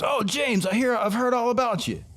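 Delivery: high, eager greeting; friend greeting. Retold or imitated. The friend meeting him, high and eager. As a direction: gush like meeting a celebrity.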